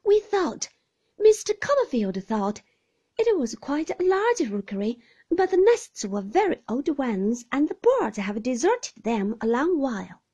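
put-on light, high voice of a timid young mother. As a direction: explain it anxiously, eager to please.

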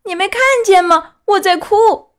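put-on high, loud tearful boy; a wooden boy still upset, answering up high; high, loud voice of a tearful puppet. A narrator's reading. Pinocchio's voice.

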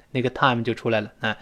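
ordinary talk, nothing marked

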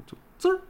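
high squeaky squirt sound; imitated squirt noise. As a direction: mimic a tiny squirting leak. Imitates small leak sound. (模仿放屁的尖细声)

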